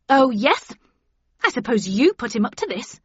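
angry, sarcastic retort; scornful anger. A narrator's reading. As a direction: throw the accusation with scorn. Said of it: "scorn and anger in her eyes".

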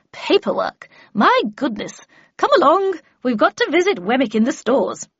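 emphatic and loud, with high swooping exclamations; brisk, bossy Miss Havisham. Acted. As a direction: chivvy a new apprentice along. Miss Havisham bustles her apprentice along.

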